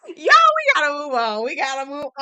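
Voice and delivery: loud laughing push to move on; real laughter, voice up. Real, not acted. Still laughing.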